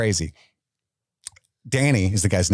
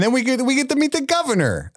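high hammed-up Italian accent; much higher, sing-song mock Italian. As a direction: say it like a cartoon Italian. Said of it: "I don't know why I got Italian".

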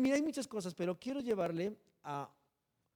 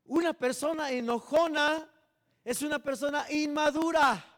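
louder and higher, hammering the point; preaching emphatically; 6 semitones higher, 9 dB louder than usual. Real, not acted. Preacher warning the church, a loud emphatic declaration. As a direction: proclaim it to the congregation.

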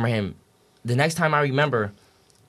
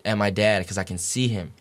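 ordinary talk

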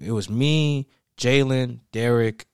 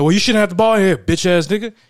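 loud, high, furious insult; angry yell; 8 semitones higher, 9 dB louder than usual. Retold or imitated. Football player cursing him out for having a ball, loud and angry. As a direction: yell it at him like he's trash.

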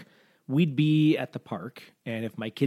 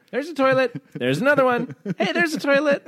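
put-on high, loud boy pointing things out; excited mock-kid voice, pitched right up; imitated excited kid; 12 semitones higher, 3 dB louder than usual. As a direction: imitate a child eagerly calling out each find. Imitates son pointing out trees.

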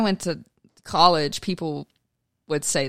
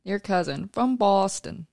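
slow, higher Boston commercial voice; Boston accent; 4 semitones higher, slower than usual. Retold or imitated. Quoting the Sam Adams commercial, Boston accent. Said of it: "the Sam Adams commercial?".

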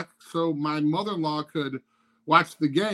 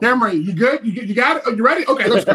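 mock coach calling; 4 semitones higher, 7 dB louder, faster than usual. Mock checking quarterback ready.

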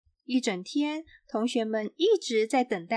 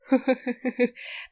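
group burst of laughing; class laughter. A narrator's reading. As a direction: laugh like kids doubled over. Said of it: "同学们都笑成一团".

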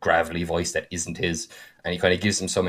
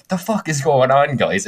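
exasperated call; 6 semitones higher, 4 dB louder than usual. Retold or imitated. Halfling calls into bloody room.

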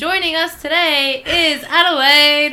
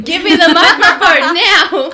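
louder, higher, laughing through it; loud laughing mock demand; 7 semitones higher, 11 dB louder than usual. Mocking friend's karaoke demand.

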